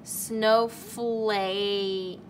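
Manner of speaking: snowflake drawn out; stretching the whole word; slow sounding out. Shown as a demonstration. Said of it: "keep saying the word".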